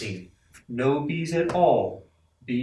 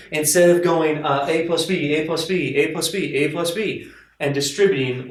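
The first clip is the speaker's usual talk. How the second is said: exasperated; 3 semitones higher, 7 dB louder than usual. Real, not acted. Exasperated at repeated expansion.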